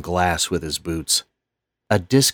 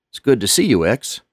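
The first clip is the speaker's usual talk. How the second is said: put-on old janitor voice; 5 semitones higher, 5 dB louder than usual. Lonnie greets X.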